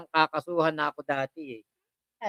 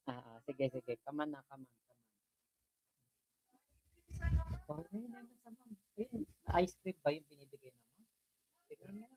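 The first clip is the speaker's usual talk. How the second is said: much softer cooing to the pet; soft coaxing; 3 semitones lower, 21 dB quieter than usual. Real, not acted. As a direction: coax it gently, almost whispering. (mahinang paglalambing sa alaga)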